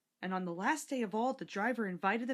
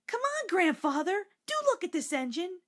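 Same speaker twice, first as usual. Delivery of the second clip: put-on high, louder excited child voice; eager children; 10 semitones higher, 4 dB louder than usual. A narrator's reading. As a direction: tug grandfather along with eager pleas. Children urging grandfather.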